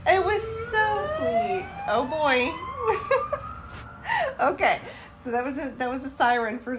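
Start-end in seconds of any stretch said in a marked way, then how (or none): 0.1-1.6 high, tearful and moved; choked up. Real, not acted. Emotional recap, laughing and crying. 1.9-4.8 loud tearful laugh, high and breaking; laughing through tears. Real, not acted. Overwhelmed, laughing and crying.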